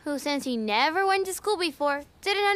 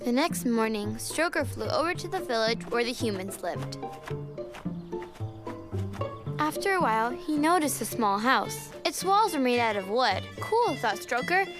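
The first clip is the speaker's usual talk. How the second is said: gentle storybook narration; reading to a toddler. A narrator's reading. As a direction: read it like a bedtime story.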